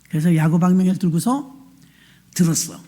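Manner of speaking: ordinary talk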